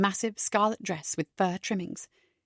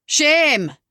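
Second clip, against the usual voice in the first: higher, loud called-out shame; 5 semitones higher, 6 dB louder than usual. Acted. Comedian teaching the crowd its reply, loud. Comedian cueing audience response.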